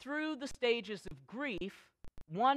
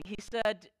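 ordinary talk, nothing marked

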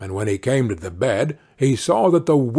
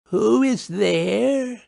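put-on high, slow old granny voice; high, drawn-out granny query; frail grandmother; 9 semitones higher, much slower than usual. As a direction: call out feebly to a visitor. Sick grandmother at the door.

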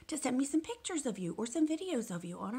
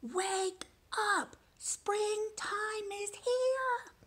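high, slow drawn-out cheerful call; yell; 13 semitones higher, much slower than usual. Shown as a demonstration. Teacher shows kids how to yell the robin part. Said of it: "And you gotta yell it".

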